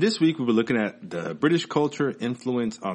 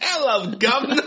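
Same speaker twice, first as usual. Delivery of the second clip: high, loud, hammed-up British accent with a laugh; a cheeky cockney greeting in a mock British accent; 4 dB louder than usual. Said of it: "That's my British accent".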